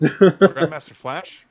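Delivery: loud, slow, drawn-out name; loud mock correction. Real, not acted. Streamer mocking his friend's name, loud and slow. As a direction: correct him like it's obvious.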